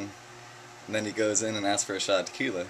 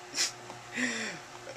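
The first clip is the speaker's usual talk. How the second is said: laughing at own joke; short high burst of laughter; 7 dB quieter than usual. Real, not acted.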